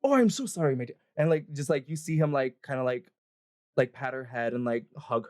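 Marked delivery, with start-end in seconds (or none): 0.0-1.0 high, louder gush of sympathy; remorseful husband in character; apologetic dwarven husband. Acted. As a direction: console her with an exaggerated apology. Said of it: "and he's like".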